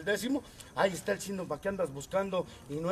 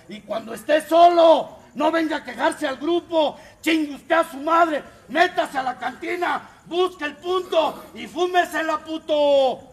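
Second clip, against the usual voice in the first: furious preaching; 6 semitones higher, 10 dB louder than usual. Real, not acted. Furious preaching at absent member.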